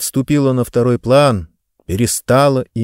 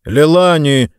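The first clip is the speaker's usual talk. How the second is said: stern father calling his daughter, louder; 4 semitones higher, 6 dB louder, slower than usual. A narrator's reading. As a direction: cut her off sternly by name. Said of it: "строгим голосом сказал он". (строго, окликая дочь)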